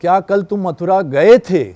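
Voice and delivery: weight thrown onto the final गए थे; stress on गए थे. Shown as a demonstration. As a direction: hit गए थे hard, questioning whether it happened. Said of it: "सिर्फ यहाँ पे बोल दिया".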